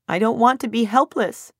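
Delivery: high, intensifying tearful cry; distraught, rising whimper; crying harder. A narrator's reading. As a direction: wail it, overcome with helplessness. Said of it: "my crying intensifies as soon as".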